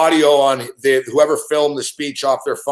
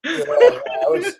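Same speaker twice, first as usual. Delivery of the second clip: laughing; 12 semitones higher, slower than usual. Real, not acted. Laughing while talking.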